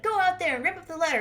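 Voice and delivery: high, loud, fast mock command; hammed-up indignant sister giving orders; bossy order. Acted sister giving an angry order.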